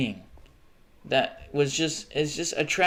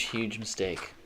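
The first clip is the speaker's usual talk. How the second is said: ordinary talk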